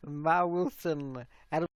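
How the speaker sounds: usual speaking voice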